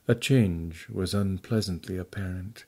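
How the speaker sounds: usual speaking voice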